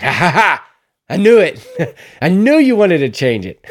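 loud, high mock-triumphant clerk with a big laugh; gleeful clap-back. Drive-thru guy startles a customer changing his order, loud gleeful teasing. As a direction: burst out cackling, as if catching the customer out. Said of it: "to clap back at me like that".

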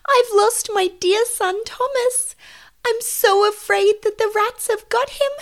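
high, loud, distraught mother wail; tearful. Acted. Mother cat fearing rats took her son, loud tearful wail. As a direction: lament loudly in a high voice through tears. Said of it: "She wiped her eyes with an apron".

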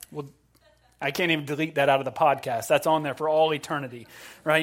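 ordinary talk

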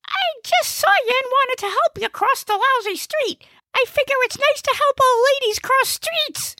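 very high, put-out comic voice; indignant Gnesi. Acted. Gnesi defends himself to the old lady, high and indignant. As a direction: protest in a high-pitched character voice.

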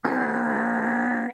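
low, rolling imitation growl; dog growl. Preacher imitates the dog heard in the yard, low growl. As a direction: imitate a pit bull's long growl. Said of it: "all of a sudden you hear".

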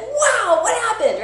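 high, loud gasp of wonder; kids' astonished outcry; amazed kids. Retold or imitated. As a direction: play the amazed kids shouting wow. Kids amazed by the fire trick.

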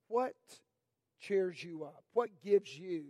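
ordinary delivery

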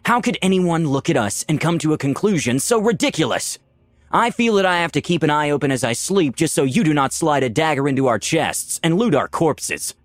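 high-pitched indignant tirade. A narrator's reading. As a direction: argue back, deeply affronted. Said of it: "Valeria retorted indignantly".

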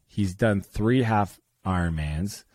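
normal talking voice

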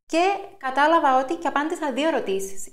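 normal talking voice

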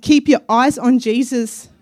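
lightly voiced God lifting her up; voicing God. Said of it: "He said".

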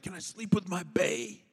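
soft bedtime ask; sleepy child. Retold or imitated. Son at bedtime asking dad, soft sleepy voice. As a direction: whisper it like a tucked-in boy. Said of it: "He's like".

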